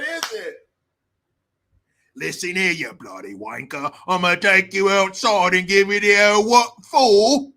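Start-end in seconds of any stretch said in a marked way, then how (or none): 2.2-7.5 hammed-up British, building louder; mock British threat. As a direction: threaten in British, swelling to loud. Acted British threat with suggested slang.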